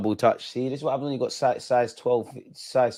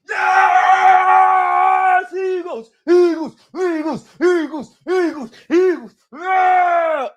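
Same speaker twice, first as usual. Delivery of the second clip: very high, very loud chanting shouts; real goal celebration; 9 dB louder, much slower than usual. Real, not acted. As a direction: chant the club name in pure joy. Team scores, chanting club name.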